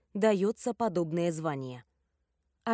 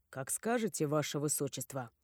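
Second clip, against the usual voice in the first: submissive; 5 semitones lower, 6 dB quieter than usual. Acted. Bodyguard bows to prince.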